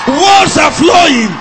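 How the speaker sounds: loud, high, drawn-out shout; full-force slow holler; preacher's scream. Real, not acted. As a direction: scream out the declaration slowly. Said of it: "scream like that".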